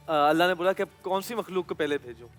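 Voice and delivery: ordinary delivery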